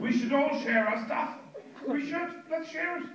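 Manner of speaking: high, slow, preachy and pleased; mocking left-winger; mock earnest lefty. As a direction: send up the do-gooder. Mocking left-wing people.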